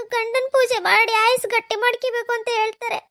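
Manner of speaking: ordinary talk, nothing marked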